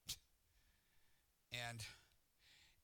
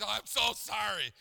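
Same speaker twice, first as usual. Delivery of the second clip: loud, high, drawn-out mock apology; hammed-up grovelling, slow and loud; over-the-top apology; 8 semitones higher, 14 dB louder than usual. Brother apologizing for the forgotten controller, mock-abject. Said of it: "he apologizes in sackcloth".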